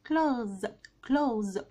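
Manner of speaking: "كلوز" as one falling syllable ending in z; correct pronunciation. Shown as a demonstration. Teacher practises along with learners, correctly pronounced "كلوز". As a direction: repeat "كلوز" with silent th and a z. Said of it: "نقولها بقى كمان مرة".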